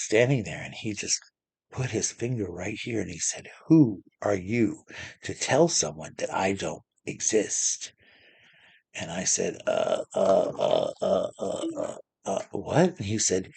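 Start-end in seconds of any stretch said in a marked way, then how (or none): 3.7-7.9 slow, deliberate confrontation; measured Santa telling off a child; slow stern scolding. Retold or imitated. 9.7-13.0 acted speechless stammering; mock frightened stutter; terrified stammer. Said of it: "like, I was terrified".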